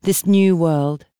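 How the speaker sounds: ordinary delivery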